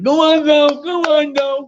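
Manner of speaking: high, excited little voice cheering go on; cheering girl. Retold or imitated. Neighbour girl cheering him on from ringside, high and excited. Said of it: "she was going like".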